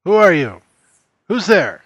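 calling out. Acted. Player calls out into dark house.

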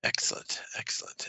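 breathy innkeeper mutter; whispered mutter. Acted. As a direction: whisper it to yourself.

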